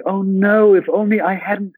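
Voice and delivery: high, distressed mock lament; guilty child. Boy blaming himself for the film's events, mock anguish. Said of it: "I was like".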